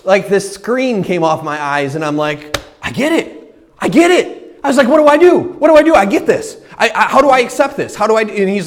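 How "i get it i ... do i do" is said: much higher and louder, eager repeated questions; bursting with need to know; breathless eager convert; urgent excitement. Retold or imitated.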